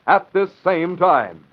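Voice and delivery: bright network station ID; announcer read. Acted. Station announcer identifying the network. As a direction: announce it crisply to listeners.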